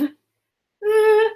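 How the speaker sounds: Uh as a long, high buzzing vvv; isolated final sound. Shown as a demonstration. Teacher isolates the end of dove, Uh as a long high vvv. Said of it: "What's the last sound".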